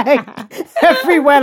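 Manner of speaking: laughing high and loud through the word. Real, not acted. Cracking up while joking with a friend, laughing. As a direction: giggle it out, barely holding together.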